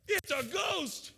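high, slow, loud cry of "It's a ghost"; fearful cry. Retold or imitated. Disciples in the boat see a figure, cry out in mock fear. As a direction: cry it out like sailors terrified in a storm. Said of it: "they cried out in fear".

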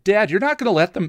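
high, fast whiny protest; protesting son. A narrator's reading. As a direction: plead with dad in a rush. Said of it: "Donnie, protested".

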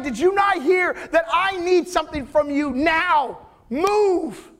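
high, pushy, shouting father; frantic demand. Acted. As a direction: hurry him up with rising fury. Jairus rants at Jesus.

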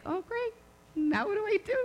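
high-pitched helpless fretting; mock dismay. Mother wondering what next after smushing spider, high dismay. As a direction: voice a lightly panicked thought, up high.

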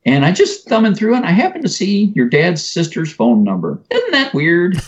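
high, chirpy imitation of mom; mom's voice. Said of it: "And she goes".

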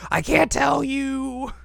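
very high, drawn-out refusal; high mock wail. Girl refusing to tell the hero, high hammed-up wail. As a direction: wail it slowly, way up in pitch.

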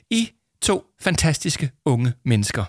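high-pitched, effusive praise; gushing teacher. A narrator's reading. Teacher comes up to Arthur and Jama, gushing. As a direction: gush over two favourite pupils.

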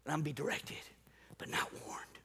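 low, near-whispered aside; hushed refusal. Acted. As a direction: drop to a whisper as you quietly refuse. Acts out quietly refusing warnings.

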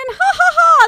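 high, loud, hammed-up fake laugh; mock laugh. Teacher laughing at his own joke, hammed-up fake laugh.

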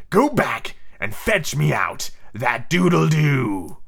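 very slow, theatrical steely villain; Hook. Shown as a demonstration. Hook ordering Ciso back into the cabin, steely and theatrical. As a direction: draw out the command in full pirate captain voice. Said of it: "in the proper Hook voice".

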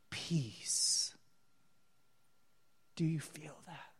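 soft, breathy whisper of peace. Real, not acted. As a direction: hush the room with a whisper. Preacher whispers peace to audience.

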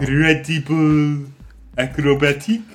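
high, slow French accent. Shown as a demonstration. Co-host showing the French accent for the host to copy. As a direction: show the French R on Creditable. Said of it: "roll my R the French way".

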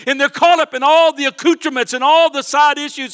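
loud, fervent preaching to the church; full-force preaching. Acted. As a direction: drive it home like a revival preacher.

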